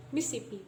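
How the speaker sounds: Mississippi shortened to "Mis-sippi"; deleted syllable. Shown as a demonstration. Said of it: "So we delete".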